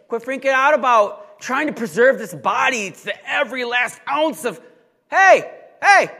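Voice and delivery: high, loud shouting to snap them out of it; shouted warning to stop freaking out; urgent wake-up call. Pretend. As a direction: yell at the congregation to wake them up. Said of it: "It's like the Lord through Peter saying".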